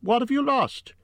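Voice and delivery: calling out. A narrator's reading. Hobbit asks the creature what is lost, calling out. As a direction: call the question over to someone unseen. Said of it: "Bilbo called".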